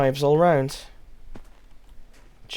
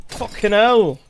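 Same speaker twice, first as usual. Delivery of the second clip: higher, louder British curse; British accent; 7 semitones higher, 6 dB louder, slower than usual. Retold or imitated. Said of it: "the more you hop around Britain".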